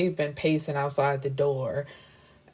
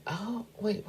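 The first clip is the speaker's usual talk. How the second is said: ordinary talk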